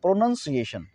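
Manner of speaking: "प्रोनाउंसिएशन" with a short 'nun' in the middle; correct pronunciation. Shown as a demonstration. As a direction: say "प्रोनाउंसिएशन" as pro-nun-see-AY-shun. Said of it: "अब नाउन कहाँ लिखा है". ("प्रोनाउंसिएशन" में 'नाउन' नहीं, छोटा 'नन' बोलें)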